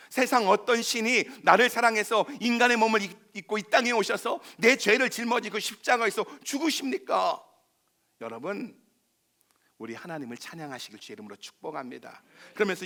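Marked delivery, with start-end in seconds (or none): 0.1-7.4 loud, high, building sermon climax; full-force preaching. Acted. Pastor assuring sinners God carries them, full-force preaching. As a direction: drive the promise home to the crowd.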